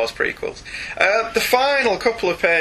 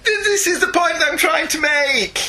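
exasperated outburst; 13 semitones higher than usual. Real, not acted. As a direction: burst out in frustration. Exasperated argument.

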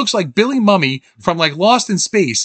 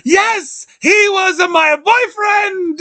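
much higher, slow shouted confession; quoting the housekeeper; 12 semitones higher, much slower than usual. Retold or imitated. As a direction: blurt it out, high and dramatic. Quoting Frau Blücher's confession.

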